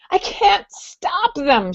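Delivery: a desperate plea while covered in spiders; panicked cry. Acted. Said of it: "she screamed".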